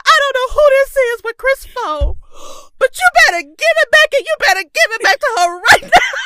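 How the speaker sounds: high, loud, laughing warning; stern Granny warning. Retold or imitated. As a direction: deliver the threat like a fierce grandmother. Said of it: "In her voice, she meant it".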